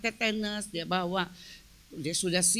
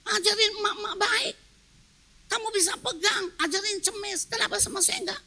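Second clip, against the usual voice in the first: high, softened mock whine; tearful complaint, retold; 8 semitones higher than usual. Wife asking why only she gets scolded, tearful complaint. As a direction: imitate a hurt, tearful wife protesting. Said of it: "Saya nangis, saya marah".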